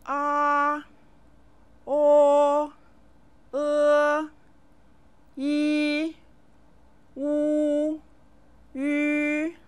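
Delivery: each vowel held high and steady, drawn out; model vowel sounds. Shown as a demonstration. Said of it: "I'll say the six simple finals".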